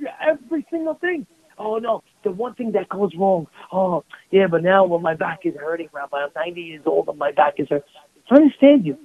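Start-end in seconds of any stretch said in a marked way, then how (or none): 1.6-7.9 a low, mock-pitiful grumble about aches; whiny old complainer. Rabbi imitating a ninety-year-old complaining about his back, lower mock whine.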